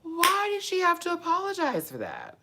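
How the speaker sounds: squealed, very high incredulous question; extreme disbelief. Real, not acted. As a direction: wail it at the top of your range. Repeats question in escalating disbelief.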